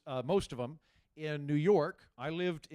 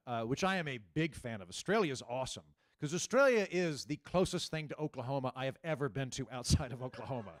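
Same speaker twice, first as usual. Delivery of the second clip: normal talking voice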